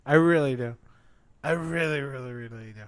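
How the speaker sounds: dejected. Real, not acted. Fan lamenting the show.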